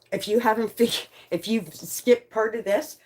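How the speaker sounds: ordinary delivery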